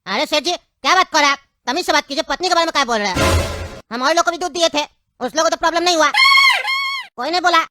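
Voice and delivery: loud outraged comeback; furious retort. Acted. Milkman defends his wife against Seth, loud furious retort. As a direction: shout him down in outrage.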